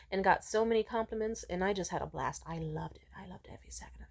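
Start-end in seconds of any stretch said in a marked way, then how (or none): 2.5-4.1 softer, whispered fond memory; delighted whisper. Real, not acted. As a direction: whisper it like a happy secret. Recalls a happy night out.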